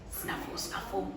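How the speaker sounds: soft, breathy snuffling whisper; hedgehog snuffle. Acted. Hedgehog snuffles in the garden, soft whisper. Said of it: "went the hedgehog".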